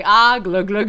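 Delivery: loud playful glug glug; glugging sound. Pretend. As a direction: gulp it down out loud, laughing.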